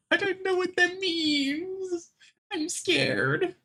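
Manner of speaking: high, squeaky laughing voice; amused, slow high-pitched protest; laughing helplessly. Real, not acted. Laughing at being called West Aussie.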